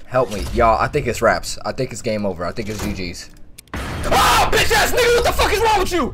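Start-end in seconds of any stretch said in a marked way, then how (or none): 3.7-6.1 high, loud furious shout; real angry outburst; aggressive yelling. Real, not acted. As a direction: scream at the animatronic in rage. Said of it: "Sorry, I got a little aggressive there".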